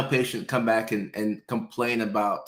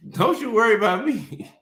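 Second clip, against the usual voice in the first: much higher, coy put-on voice; 11 semitones higher than usual. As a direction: tease the doctor sweetly. High put-on reassuring voice.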